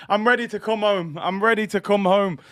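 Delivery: usual speaking voice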